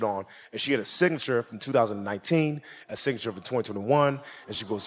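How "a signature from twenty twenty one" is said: ordinary delivery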